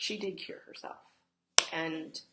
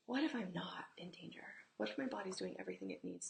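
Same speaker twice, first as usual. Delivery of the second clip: whisper; 6 dB quieter than usual. Shown as a demonstration. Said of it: "the emotional medicine is those what if questions".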